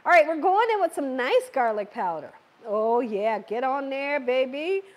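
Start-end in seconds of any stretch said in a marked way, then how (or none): none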